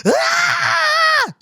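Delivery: a high, far-off shouted cheer from the audience; crowd cheer. Retold or imitated. Dancer mimics the audience erupting, high cheering shout. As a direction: yell like a whole crowd going wild. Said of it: "the whole fucking audience is like".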